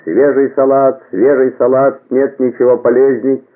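high, loud greengrocer's call; hawking vegetables to the whole street; street vendor's cry. A narrator's reading. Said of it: "А зеленщицы кричали".

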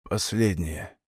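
fading voice with no strength left; deathbed whisper. Acted. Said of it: "слабеющим голосом проговорил".

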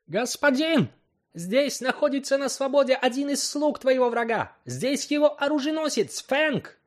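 high, loud angry call; furious shout. Acted. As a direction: yell out in fury. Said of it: "кричал яростно Капома". (яростный крик)

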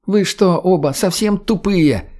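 high, slow incredulous jab; exasperated outburst. Acted. Redhead to two captors, high exasperated outburst.

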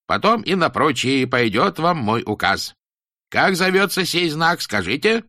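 high, slow, solemn schoolmaster; stern teacher voice. Acted. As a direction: preach it like a strict old teacher. Teacher addresses pupils.

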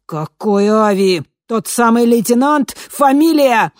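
loud rising interrogation; demanding shout. A narrator's reading. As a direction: press him hard.

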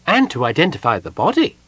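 cheerful and tactless; a hint of cheerful Kender. A narrator's reading. Tass cheerfully mentions identifying the body.

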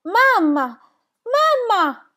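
very high, loud scream of horror; girl screaming over her dead mother; anguished scream. Acted. Discovers mother's body.

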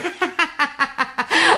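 a high, rising laugh to cover a slip; embarrassed laugh. Acted. Eder covers his slip with laughter.

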